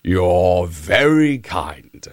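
low, slow lion; lion's voice. Acted. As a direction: drop it down and slow as the big lion. The lion answers the hare.

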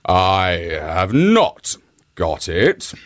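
slow, low growl of a beaten lion; lion growls to the hare, low and slow. A narrator's reading. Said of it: "growled the lion".

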